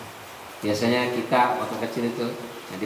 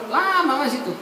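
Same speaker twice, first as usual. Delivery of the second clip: usual speaking voice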